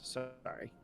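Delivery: normal talking voice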